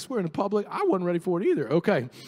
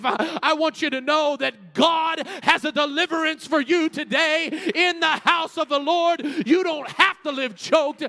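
rising preaching; 5 semitones higher than usual. Acted. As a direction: drive it home to the congregation. Preaching builds to full force.

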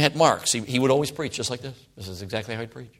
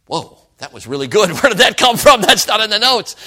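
amazed thought; 7 semitones higher, 7 dB louder than usual. Retold or imitated. Retold surprise at own preaching.

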